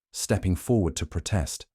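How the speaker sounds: plain reading voice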